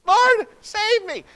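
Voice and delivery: very high, loud, slow pleading shout; desperate cry. Retold or imitated. Peter sinking begs Jesus to save him, desperate shout. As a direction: beg for rescue at the top of your voice. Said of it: "Peter says".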